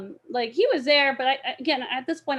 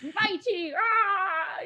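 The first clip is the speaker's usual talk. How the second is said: mock battle cry; 9 semitones higher, much slower than usual. Reenacting fight cry.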